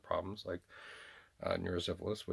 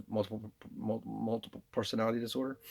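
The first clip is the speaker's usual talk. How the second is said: stumbling on "multiple", restarting it; false starts before finishing the term; tripping over words. Real, not acted. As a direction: get stuck on "multiple", then push through. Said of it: "we tripped him up on that".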